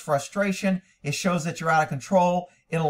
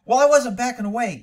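higher, louder whiny owner excuse; mocking owner; 6 semitones higher, 5 dB louder than usual. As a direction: imitate a defensive horse owner. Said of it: "They're like".

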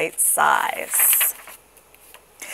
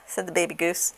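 ordinary delivery, nothing marked

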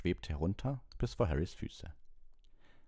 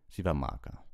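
plain reading voice

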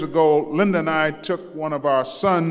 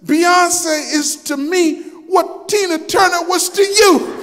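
high, laughing punchline; son's clever comeback; 13 semitones higher, 5 dB louder than usual. Retold or imitated. Son explaining [name] to his dad, laughing and loud. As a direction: land the boy's retort with a laugh. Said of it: "He said".

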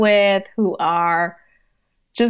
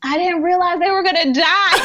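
mock shocked surprise; 10 semitones higher, 3 dB louder than usual. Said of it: "I'll come home and be like".

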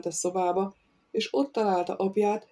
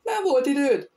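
sharply higher, louder retort; snapping at; 7 semitones higher, 5 dB louder than usual. A narrator's reading. Innkeeper snapping at his father in disbelief, higher and louder. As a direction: bark the question back in outrage. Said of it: "riadt rá a kocsmáros".

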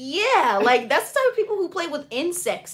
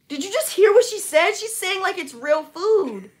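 incredulous; 8 semitones higher, 8 dB louder than usual. Real, not acted. Incredulous at friend's claim.

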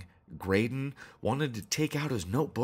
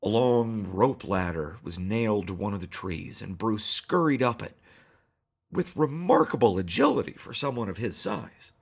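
plain reading voice

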